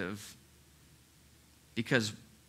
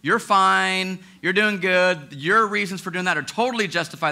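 loud, high self-justifying inner voice; the flesh soothing you, louder and higher; 7 semitones higher, 9 dB louder than usual. Retold or imitated. Pastor voicing the flesh excusing itself, loud and high. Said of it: "And he's going".

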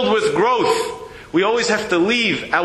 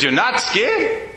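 drawn-out incredulous repeat, rising at the end; the woman repeats her question slowly, in disbelief; slow disbelieving question; 5 semitones higher, much slower than usual. Retold or imitated. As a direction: ask it again slowly, as if you can't believe it.

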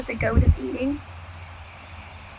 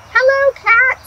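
high, bright greeting as Duck; cheerful duck greeting; 11 semitones higher, 7 dB louder than usual. Acted. Duck calling hello to Cat, high and cheerful. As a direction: chirp a friendly hello up high.

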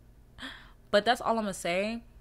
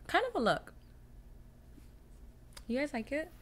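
usual speaking voice

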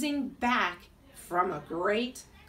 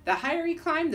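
ordinary delivery

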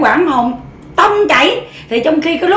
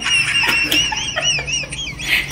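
high, long burst of laughter; long high cackle; 6 dB quieter than usual. Real, not acted. Vlogger doubled over at her own story, long high cackle.

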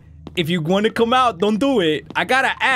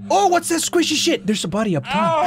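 high, tense exclamation; alarmed disgust; 9 semitones higher than usual. Real, not acted. Player finds a body up top, high alarmed voice. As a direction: pitch it up in dread. Squishy noise, body overhead.

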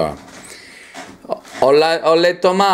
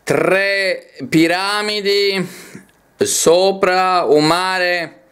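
slow, measured poetry reading; poem recitation; 3 semitones higher, slower than usual. Acted. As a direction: declaim each phrase, lingering on the stressed vowels. Reads a poem aloud.

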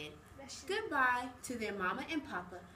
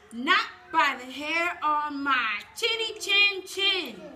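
little pigs defiant; 3 semitones higher, 8 dB louder than usual. Acted. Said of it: "they said".

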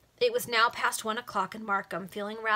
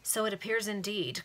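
usual speaking voice

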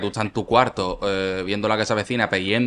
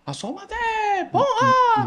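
high-pitched mock call breaking into a laugh; imitated fan yelling; slower than usual. Kids shouting under his window, high mock yell into laughter. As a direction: shout up at the window like an excited kid, then crack up. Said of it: "hay gente gritando".

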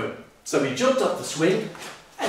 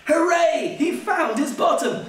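high sing-song hooray; joyful cheer; 11 semitones higher than usual. Acted. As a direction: cheer it out with glee. Joy at finding bottom.

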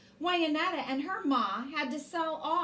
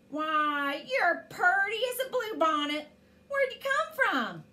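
high, sweet giant woman, rising in surprise; a lightly voiced giant woman, higher and delighted; 5 semitones higher, 4 dB louder than usual. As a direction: coo at her like a kindly giant lady. Giant woman greets the girl.

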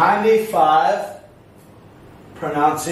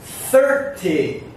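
first syllable of thirty raised, second falling; stress on THIRty. Shown as a demonstration. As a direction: hit THIR, then let ty drop. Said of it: "Listen for the stress".

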